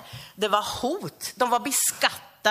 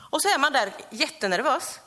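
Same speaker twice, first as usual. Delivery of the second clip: ordinary delivery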